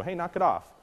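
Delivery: telling the dog off; firm correction. Shown as a demonstration. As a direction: snap the dog out of it. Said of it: "You go".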